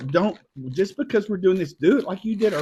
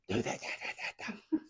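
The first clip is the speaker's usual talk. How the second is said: whispered mimicry of a bossy dad; mock bossy dad; 4 semitones higher, 18 dB quieter than usual. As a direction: imitate dad ordering around, hushed.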